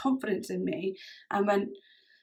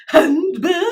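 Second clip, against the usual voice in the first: theatrical outrage; 8 semitones higher, 12 dB louder than usual. Pretend. Theatrical handbag outrage quote.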